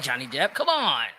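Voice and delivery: ordinary delivery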